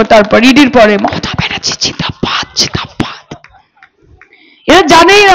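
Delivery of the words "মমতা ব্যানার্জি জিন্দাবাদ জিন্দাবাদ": mock the idea of a frightened, hushed slogan; a barely audible whispered জয় Bangla; mock timid whisper. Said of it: "আস্তে আস্তে বলবো".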